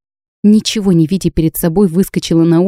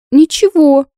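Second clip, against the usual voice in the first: much higher, louder tearful nothing; 9 semitones higher, 4 dB louder, slower than usual. A narrator's reading. A sobbing girl brushes off her friend's question, tearful. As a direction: squeeze the word out through sobs.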